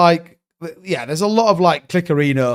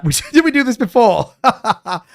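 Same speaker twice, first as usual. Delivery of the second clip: laughing; 6 semitones higher than usual. Real, not acted. Laughing at own chair joke.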